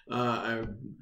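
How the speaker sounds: ordinary talk, nothing marked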